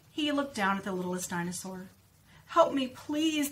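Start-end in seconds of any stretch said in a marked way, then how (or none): 2.5-3.5 pleading cry. A narrator's reading. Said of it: "the littlest dinosaur cried".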